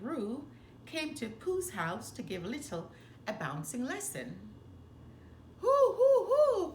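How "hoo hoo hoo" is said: high, loud hooting laugh; Tigger's whooping laugh. Acted. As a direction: whoop it out like a bouncy tiger. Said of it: "cried Tigger".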